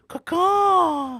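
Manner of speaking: very high, loud caw; high, loud mock squawk; imitated movie bird call. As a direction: screech it like the guy on the mic. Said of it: "he's, like, on the microphone".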